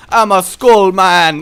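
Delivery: put-on slow, loud Jamaican accent; Jamaican skull man. Gamer imagining a talking skull, mock Jamaican accent. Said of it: "It's gotta be Jamaican".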